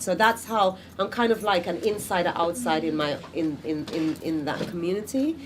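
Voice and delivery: ordinary talk, nothing marked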